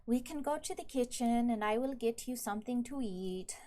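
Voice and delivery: a hint of a gruff kitchen woman inviting a guest; Ruza the cook. GM voices Ruza offering food.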